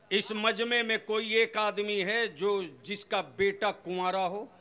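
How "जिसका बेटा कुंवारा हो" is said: usual speaking voice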